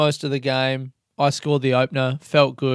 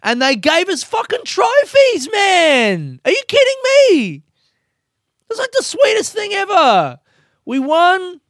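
very high, loud thrilled shouting; high, loud exultant cheer; excited yelling; 6 dB louder than usual. Real, not acted. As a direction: shout it like you just won the cup. Celebrating trophies.

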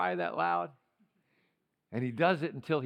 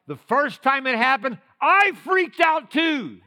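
mock freaked-out shout; 9 semitones higher, 11 dB louder than usual. Retold panic, shouted.

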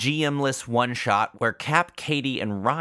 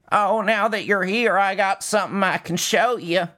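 character voice; 9 semitones higher, 4 dB louder than usual. Acted. Promo character voice.

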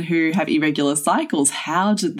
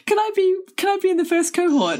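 eager high plea; 13 semitones higher than usual. Pretend. Eager imagined request to join.

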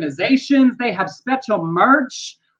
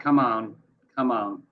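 much lower, quieter coaxing; tired guard mumble; 11 semitones lower, 6 dB quieter than usual. Retold or imitated. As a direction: coax her along, low and soft. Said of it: "security guard who's just walking".

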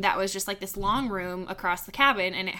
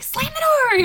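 high, louder imitation of panic; mock panicked cry; 6 dB louder than usual. Girls running from moths, mock panicked cry. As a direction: cry it out as if fleeing.

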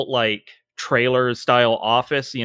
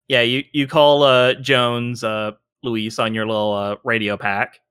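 ordinary talk

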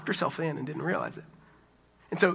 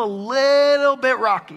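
"little" drawn out long, high and loud; "little" held on a raised, louder note; LITTLE stretched out; 7 semitones higher, 9 dB louder than usual. Pretend. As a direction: stretch "little" mockingly before "bit rocky". Mocking the idea of partial calm.

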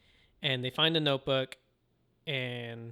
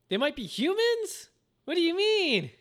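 very high, loud incredulity; hammed-up, high-pitched bewilderment; mock shocked disbelief; 6 dB louder than usual. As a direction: act out a mind-blown viewer. Voicing viewers' shock at revelations.

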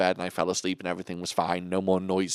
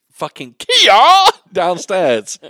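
loud high shouted name; hammed-up karate cry; mock karate yell; 11 semitones higher, 14 dB louder, much slower than usual. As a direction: yell it like a kiai. Imitating karate yell noise.

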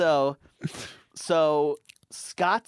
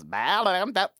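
mock Jamaican; 7 semitones higher, 4 dB louder, slower than usual. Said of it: "and she goes".

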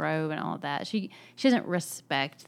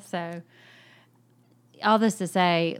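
ordinary delivery

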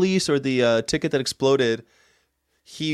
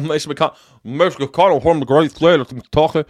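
a hint of a Kentucky senator; mock senator announcing his home state; Mitch McConnell impression; 2 semitones higher, 4 dB louder than usual. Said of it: "Mitch McConnell's like this".

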